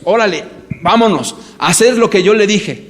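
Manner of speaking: put-on bully the child into obeying; violent father. Father pulling his son by the hair, angry loud orders. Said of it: "cada vez me vuelvo más violento".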